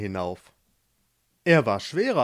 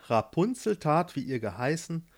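plain reading voice

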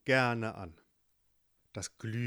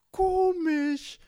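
very high squeaky critter voice, louder; tiny ladybug; 8 dB louder than usual. Acted. Ladybug startled by the knock, tiny high squeak.

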